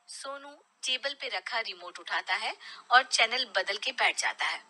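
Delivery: ordinary delivery, nothing marked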